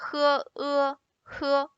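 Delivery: "he" then "e", sounded separately and joined into "he"; "he", "e", "he", all with an "uh" vowel; blending initial and final. Shown as a demonstration. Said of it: "put them together".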